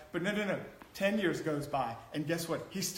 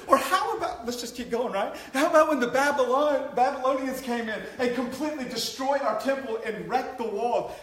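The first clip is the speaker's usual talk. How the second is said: excited build; 6 semitones higher, 6 dB louder than usual. Real, not acted. Ironic list of dark times.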